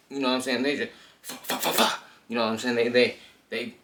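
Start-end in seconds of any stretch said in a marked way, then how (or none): 1.2-2.0 quick high unvoiced punch noises, like blows landing; fighting noises. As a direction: rattle off unvoiced fa fa fa sounds as fists fly. Mimicking blows being thrown.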